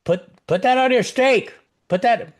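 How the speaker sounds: high-pitched imitation of a scolding waitress; disappointed waitress. Waitress correcting a diner about blue cheese, disappointed scolding. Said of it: "she was very disappointed".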